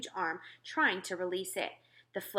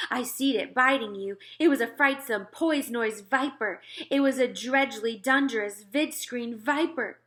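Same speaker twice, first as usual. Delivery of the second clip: higher, louder scary warning; mock alarm; 4 semitones higher, 6 dB louder than usual. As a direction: spook him with the viper tale. BFG frightens the other giant.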